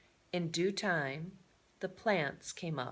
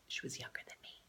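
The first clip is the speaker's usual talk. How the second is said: soft secret whisper; whispered aside; 6 semitones lower, 14 dB quieter, much faster than usual. A narrator's reading. Narrator sharing a private joke, whispering. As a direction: breathe it like a confidence.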